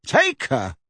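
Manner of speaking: short burst of false heartiness; forced hearty. A narrator's reading. Boy lending his owl, falsely hearty. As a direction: offer it with put-on cheer. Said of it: "hearty voice that did not belong to him".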